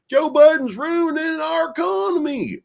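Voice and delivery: very high, squealed caricature; mock right-wing Republican. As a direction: whine it as a ridiculous political parody. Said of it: "I assume they talk like that".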